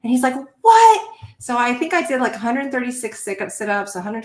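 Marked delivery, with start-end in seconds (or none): 0.6-1.1 sudden high, loud disbelieving "What?"; shocked what. Retold or imitated. Recruit stunned she beat him, loud shocked yelp. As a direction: blurt it out, stunned. Guy hears her push-up count.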